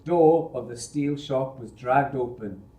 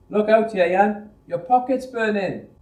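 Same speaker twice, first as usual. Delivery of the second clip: warning call; 8 semitones higher, 7 dB louder than usual. Acted. Thomas warns Yayan.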